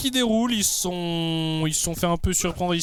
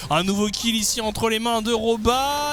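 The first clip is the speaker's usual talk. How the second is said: raised, lively play-by-play call; esports caster; 10 semitones higher than usual. Acted. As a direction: call the fight live, high and energetic. Live play-by-play.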